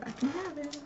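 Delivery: high, soft little voice; small high echo. Real, not acted. Crafter echoing permission to have the item, small high voice.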